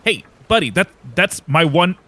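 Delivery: a touch of mock anger; annoyed lookout. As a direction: grumble down at someone who stole your moment. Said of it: "looks down at you with anger".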